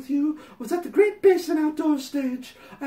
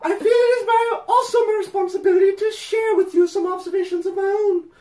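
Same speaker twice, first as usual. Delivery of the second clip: hammed-up letter voice; 4 semitones higher, 4 dB louder than usual. Reading the letter to Patty, hammed-up prim voice. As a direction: read it like a sanctimonious fan pouring her heart out. Reads fan letter aloud.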